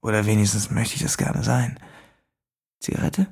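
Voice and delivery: leise, raunende Fremdenstimme; düster und gedämpft aus dem Schatten; unheimlicher Fremder. A narrator's reading. Fremder spricht. (geheimnisvoller Fremder im Dunkeln, leise raunend)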